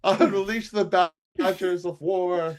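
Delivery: high, loud silly war cry; mock battle cry. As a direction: shout it like a hammy general.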